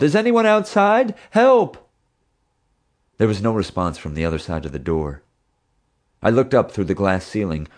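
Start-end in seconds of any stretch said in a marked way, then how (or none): 0.0-1.8 very high, loud cry for help; yelling for help. A narrator's reading. Teddy trapped in the tube calling to anyone outside, loud high yell. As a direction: scream it through the steel door. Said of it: "I yelled".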